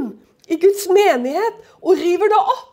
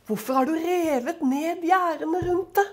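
quietly read, a softer voice; hushed, gentle reading of the verse; 7 dB quieter than usual. Acted. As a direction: read it softly, almost to yourself. Preacher reads the verse twice.

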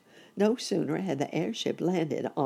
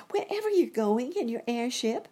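put-on much higher, pleading little-boy voice; eager boy rabbit; 10 semitones higher than usual. A narrator's reading. Boy rabbit asking his uncle for a ride, high eager child voice. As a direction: pipe up high like a hopeful child.

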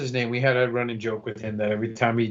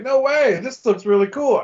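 loud, high, thrilled exclamation; excited; 9 semitones higher, 5 dB louder than usual. Retold or imitated. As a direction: sound amazed and thrilled. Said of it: "I was like".

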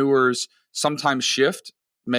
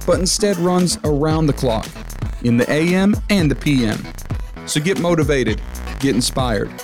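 bright, louder podcast intro over music; upbeat travel show host; 2 semitones higher, 3 dB louder than usual. Acted. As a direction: pump up the listener like a show opener.

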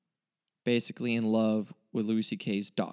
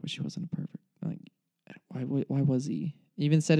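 usual speaking voice

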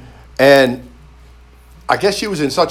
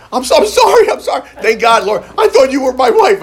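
flustered apology; 9 semitones higher, 5 dB louder than usual. Retold or imitated. Flustered apology to second stranger.

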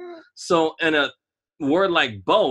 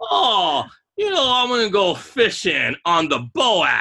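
mock Southern accent; 5 semitones higher than usual. Making co-host laugh with an example, mock Southern accent. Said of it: "That's how they say it".

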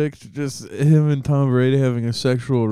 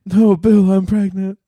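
higher, louder melodramatic plea; hammed-up pregnant plea; 7 semitones higher, 6 dB louder than usual. Cohost plays Brady confessing to Bill, melodramatic and loud. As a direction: overplay it like a soap opera. Acting Belichick-Brady skit.

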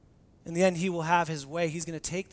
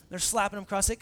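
ordinary talk, nothing marked

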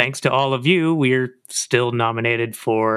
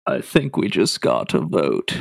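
swallowed throaty cohost voice; 4 semitones lower than usual. Pretend. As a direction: keep the voice swallowed down. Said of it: "changing your throat to not sound like you".

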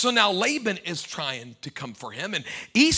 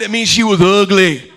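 loud punchline; 13 dB louder than usual. Shown as a demonstration. Preacher repeats the joke to the congregation, loud and laughing. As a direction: belt it out with a laugh.

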